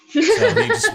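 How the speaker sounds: squealing laugh through words. Real, not acted. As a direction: laugh it out, very high. Laughing at his own move.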